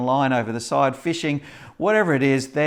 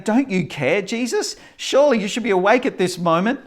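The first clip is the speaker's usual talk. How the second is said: high, urgent pleading to a sleeping master; frightened disciples begging Jesus to wake; 8 semitones higher than usual. Acted. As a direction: call out to Jesus in alarm, pitched up.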